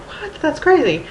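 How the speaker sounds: high-pitched amazed reaction; astonished little quote; mock disbelief. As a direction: react as if you can't believe it. Acting out reaction to old photo.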